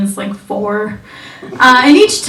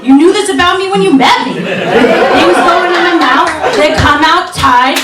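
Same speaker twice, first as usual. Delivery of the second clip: put-on smug girlfriend; 6 semitones higher than usual. Girlfriend schooling her boyfriend, lightly smug. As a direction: lay it out like it's obvious. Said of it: "She's like".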